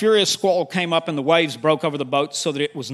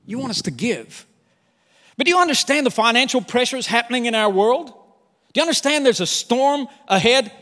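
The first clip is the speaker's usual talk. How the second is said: a believer arguing with Jesus, building louder and higher; lightly voiced anxious protest, growing insistent; worried believer pleading; 5 semitones higher, 4 dB louder than usual. Believer pleading with Jesus about money worries, building to a louder complaint. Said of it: "you go".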